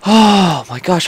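released breath after holding it; slow, loud gasp of relief. Real, not acted. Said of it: "I'm holding my breath".

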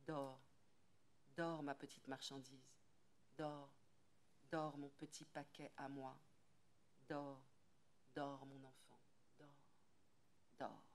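put-on rock the baby to sleep with a hushed croon; hushed lullaby. A narrator's reading. Woodcutter's wife hushing the baby to sleep, soft lullaby. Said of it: "chuchote à son oreille, comme une chanson, une berceuse".

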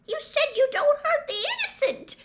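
very high, loud, desperate protest; tearful shout. A narrator's reading. Hermione appeals to centaurs in tears, high desperate shout. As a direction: cry out shrilly in protest. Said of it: "shouted Hermione".

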